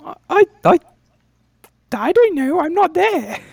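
tiny high-pitched insect voice; high squeaky cricket voice. Acted. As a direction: squeak out the order.

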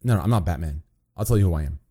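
normal talking voice

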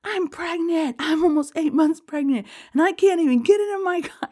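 high, loud, indignant outburst; angry rant. Retold or imitated. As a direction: vent the outrage of being boxed in. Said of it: "and I'm so mad".

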